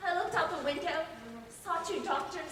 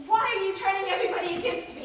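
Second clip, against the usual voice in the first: raised, higher accusing cry; louder, pleading accusation; angry outcry; 5 semitones higher, 7 dB louder, faster than usual. Acted. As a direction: hurl the question at him. Confronted in asylum.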